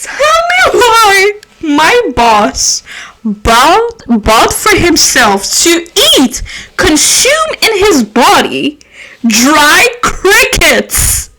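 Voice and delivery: slow, drawn-out disbelief; dramatic slow disbelief; incredulous. Real, not acted. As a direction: stretch each word in shock. Outraged disbelief at boss.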